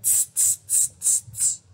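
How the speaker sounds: soft voiceless tss tss tss; tut-tut like a cheeky monkey; monkey tsk. Acted. Monkeys tutting back at the peddler, soft voiceless tss. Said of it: "And they said".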